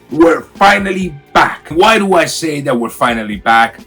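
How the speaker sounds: ordinary talk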